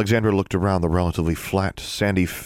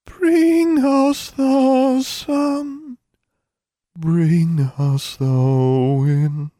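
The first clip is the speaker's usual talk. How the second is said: high, slow, louder plant voice; tiny voice; 6 dB louder, much slower than usual. Acted. Tiny corn plant begs Alexandra for the sun. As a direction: plead like a tiny withering spirit. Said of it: "a tiny voice said".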